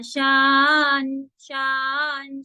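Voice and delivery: शान stretched very slowly; slow drawn syllable. Shown as a demonstration. Teacher demonstrating the stretched sound, very slow. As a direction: hold शान long and even. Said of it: "शरा नहीं है".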